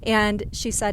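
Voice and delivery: usual speaking voice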